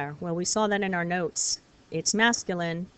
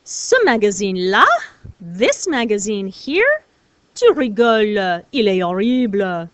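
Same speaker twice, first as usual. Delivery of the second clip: "magazine-là" punched loud with a rise; teacher showing off "là" with loud disgust; emphatic là; 8 dB louder than usual. Shown as a demonstration. French teacher demos "Ce magazine-là" with loud scorn. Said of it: "It's used for emphasis".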